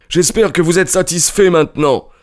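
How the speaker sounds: high-pitched testy question; peevish householder's challenge; ill-humoured. A narrator's reading. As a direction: ask with irritation, voice pitched well up. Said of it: "interrogea avec humeur monsieur Cunningham".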